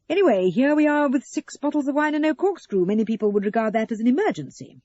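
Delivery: high, loud, pressing the point; emphatic Russell. A narrator's reading. Russell moving on to the missing corkscrew, emphatic. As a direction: declare it loudly, like a mock emergency.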